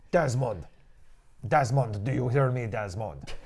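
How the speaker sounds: mock Ezio with a thick fake Italian accent; hammed-up Italian accent, calling a name; badly faked Italian accent. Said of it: "he had a very bad Italian accent".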